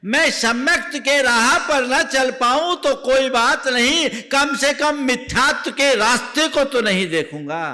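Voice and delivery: a preacher rousing his listeners with a vow; fervent preaching. Acted. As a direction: proclaim the vow to the crowd. Preacher voices a resolve.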